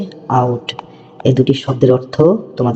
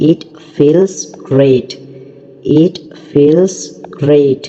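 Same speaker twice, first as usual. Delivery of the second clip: very slow model reading; much slower than usual. Shown as a demonstration. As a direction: try it out, each word drawn out very slowly. Teacher models English phrase slowly. (খুব ধীরে ধীরে স্পষ্ট উচ্চারণ)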